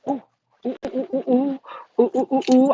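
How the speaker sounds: rapid string of short oohs; sudden real alarm in little repeated hoots. Real, not acted. Driver suddenly realizing she must move her car, alarmed oohs.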